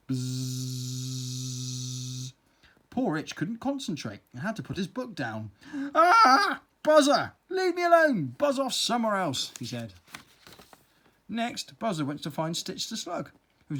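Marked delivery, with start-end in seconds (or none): 0.1-2.3 low buzzing hum; bee buzzing. Acted. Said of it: "the sound of Buzzer buzzing". 5.9-9.5 high, loud angry yell; angry shout. Acted. Ant shouts at pestering bee.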